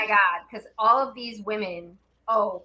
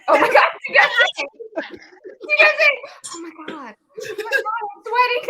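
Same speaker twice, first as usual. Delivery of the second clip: high, hammed-up freak-out; imitated frantic disbelief; 10 semitones higher, 3 dB louder, faster than usual. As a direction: act out a friend losing it, pitch climbing. Imitating friend's frantic repeated reaction.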